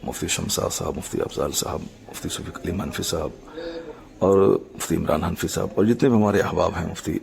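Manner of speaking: ordinary delivery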